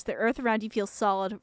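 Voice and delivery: usual speaking voice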